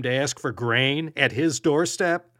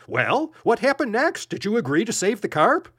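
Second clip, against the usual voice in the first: higher, hurried impatient question; 8 semitones higher than usual. A narrator's reading. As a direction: push him to get on with it, fast. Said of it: "said impatiently".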